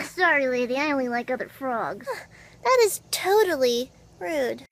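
usual speaking voice

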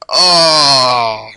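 loud, long "oh" cry on crashing; startled cry. Real, not acted. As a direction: cry out as it all goes wrong. Crashes while flying in game.

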